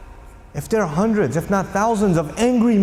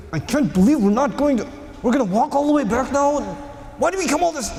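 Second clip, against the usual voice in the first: rising, higher indignant whine; complaining followers; 4 semitones higher than usual. Retold or imitated. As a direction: build the complaint upward. Companions complaining voiced.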